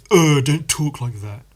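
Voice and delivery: imitated disgusted, dismissive telling-off; mock a condescending "Oh" of disapproval; condescending rebuke. Answering someone who used slang, mock condescension. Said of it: "the condescension of it".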